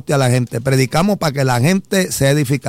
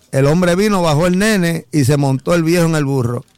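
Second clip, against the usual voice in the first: ordinary delivery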